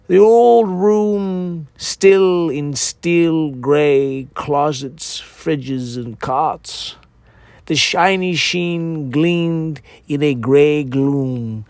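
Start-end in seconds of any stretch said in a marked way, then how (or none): none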